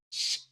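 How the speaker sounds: soft hissed "shh"; a soft drawn hush; imitated shush noise. Retelling going inside away from the kid, hushing noise.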